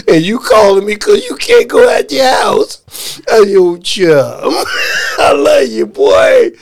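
loud, high, laughing through the words; laughing yell. Retold or imitated. Mocking Tom's worries to him, loud laughing yell. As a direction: shout it while cracking up. Laughing while quoting himself to Tom.

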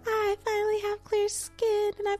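very high, squeaky gushing; mock delight. Podcaster mimicking herself over clear skin, mock delight. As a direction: gush in a high, put-on squeal.

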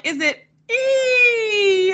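high "E" stretched into a mock scream; drawn-out falling cry. Imitating pastor joking about falling to the ground, mock scream. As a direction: let "E" wail and sink slowly.

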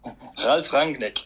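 "Ralf Rangnick" slowly, R's rolled; model German pronunciation. Shown as a demonstration. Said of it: "practice rolling your R's".

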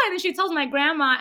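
ordinary talk

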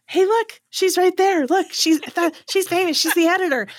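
loud, high, proud husband showing off. Retold or imitated. Husband showing my picture to shoppers, loud and proud. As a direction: boast to strangers like a proud husband. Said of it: "will show anybody walking by".

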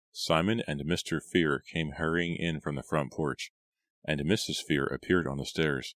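ordinary delivery

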